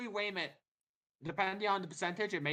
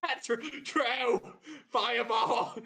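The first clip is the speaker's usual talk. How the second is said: mock crying; 9 semitones higher, slower than usual. GM mock-cries over fireball.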